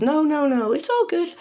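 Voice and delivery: very high-pitched polite refusal; extremely high character voice; Nezu. Acted. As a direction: wave it off in a squeaky-high voice. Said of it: "And Nezu goes".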